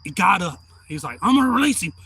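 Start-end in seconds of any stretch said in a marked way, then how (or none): 1.2-1.9 raised, high cop warning; loud imitation of a police warning; acted cop warning. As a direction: call it out like an officer. Acting cop's warning.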